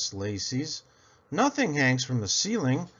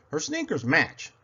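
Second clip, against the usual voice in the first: ordinary delivery, nothing marked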